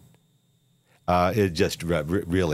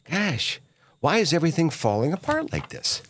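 higher, fast, mock dismay; mock bewilderment; 5 semitones higher than usual. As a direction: play dumb astonishment, quickly. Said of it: "And then we keep wondering".

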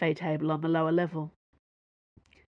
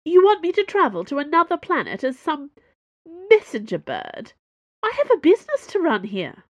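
indignant; 10 semitones higher, 7 dB louder than usual. Acted. Indignant refusal to travel.

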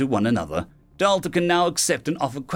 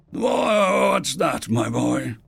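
put-on old father voice; much slower than usual. A narrator's reading.

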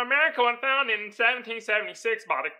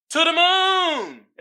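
hyped; 10 semitones higher, 4 dB louder, much slower than usual. Real, not acted. Hyped prediction then laugh.

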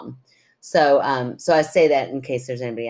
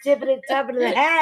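silly sing-song; 11 semitones higher than usual. Pretend. Silly chant exchange.

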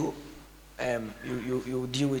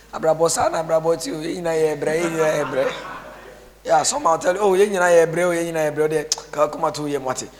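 higher, loud mock wailing; mock lament; 7 semitones higher, 9 dB louder than usual. Pastor mocking people consoling the sick, loud. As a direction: ham up the comforting chant.

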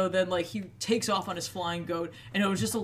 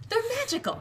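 much higher, squeaky mock voice; faster than usual. Shown as a demonstration. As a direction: squeak it out high as a joke. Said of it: "Well, they're magical ravens".

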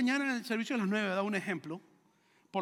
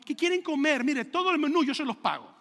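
high, louder, eager offer; mock-flustered generosity, pitched up; overeager offer; 5 semitones higher, 5 dB louder than usual. Embarrassed pastor offering to pay for the meal, overeager.

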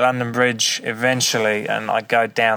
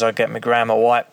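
ordinary talk, nothing marked

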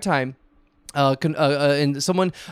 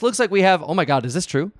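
ordinary talk, nothing marked

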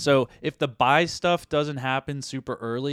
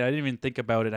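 ordinary delivery

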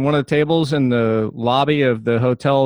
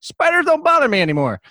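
high, excited; 12 semitones higher than usual. Retold or imitated. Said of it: "I'm like".

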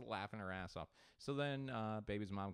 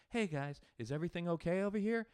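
lightly voiced chipper server, pitched up; server check-in; 8 semitones higher, 5 dB louder than usual. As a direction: ask the table brightly, voice lifted.

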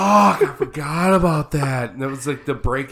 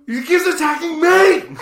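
high, loud comic wail; mock panicked yell; 8 dB louder than usual. Imitating a stuntman attacked by a ram, high panicked yell. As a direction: cry out as if under attack.

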